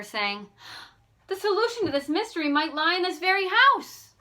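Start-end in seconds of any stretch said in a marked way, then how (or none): 1.3-4.1 much higher, louder eureka outburst; a burst of sudden realization; sudden excitement. A narrator's reading. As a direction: leap up with the discovery. Nancy jumps up, sudden idea.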